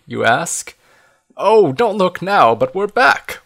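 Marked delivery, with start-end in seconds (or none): 1.4-3.4 higher, drawn-out warning; Fox's warning. A narrator's reading. Fox warning the group they are back, uneasy warning. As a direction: warn them with raised pitch, stretching the opening. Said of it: "warns Fox".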